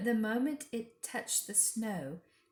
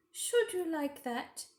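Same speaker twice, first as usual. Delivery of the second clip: high, slow, syrupy queen; scheming queen being gracious; 8 semitones higher than usual. A narrator's reading. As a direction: offer it sweetly and slowly, like a trap. The Queen's voice in narration.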